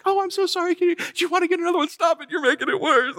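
high, fast, mock-sympathetic young lady; young woman's pity. Preacher mimics the young lady who offered him another burger, high and fast. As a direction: pitch it up as a gushing helpful stranger. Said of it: "she comes up and she's like".

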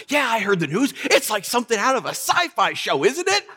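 high, bubbly imitation of a delighted teacher; mock-cheerful, pitch climbing to the end; gleeful teacher. Teacher grinning at student over terrible news, mock gleeful. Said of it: "she got this big smile on her face".

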